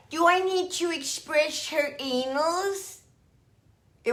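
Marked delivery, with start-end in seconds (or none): 0.1-3.0 high, slow, theatrical groomer voice; imitating the groomer. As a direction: drawl it like a showy diva. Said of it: "she had a voice like that".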